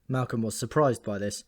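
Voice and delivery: ordinary delivery, nothing marked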